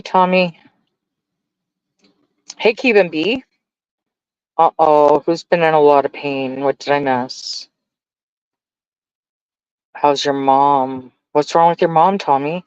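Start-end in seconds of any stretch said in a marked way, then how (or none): none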